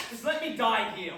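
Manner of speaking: ordinary talk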